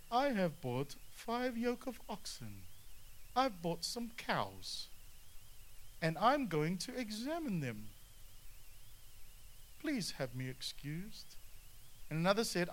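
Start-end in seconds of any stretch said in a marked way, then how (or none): 0.1-7.9 mock excuse. 9.8-11.3 mild mock plea.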